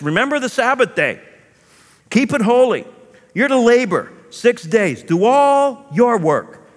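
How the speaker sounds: loud, high solemn proclamation; scripture reading. Acted. Preacher reading the Sabbath commandment from Exodus, solemn.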